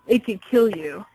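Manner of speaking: ordinary talk, nothing marked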